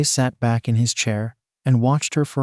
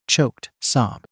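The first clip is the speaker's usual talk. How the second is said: plain reading voice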